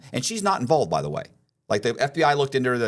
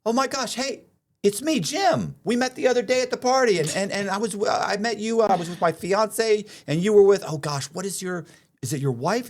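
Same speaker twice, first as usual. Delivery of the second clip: high, gushing fake-friend voice; overly friendly con man; 11 semitones higher, 3 dB louder than usual. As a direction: gush like you know him well. Con man acting friendly stranger.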